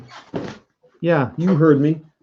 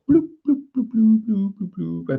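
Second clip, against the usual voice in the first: bloop sounds; 8 semitones higher than usual. Pretend. Voicing dice or counter sounds.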